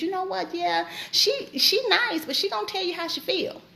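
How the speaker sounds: a hint of onlookers' commentary; others talking about you. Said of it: "what they're going to say about you".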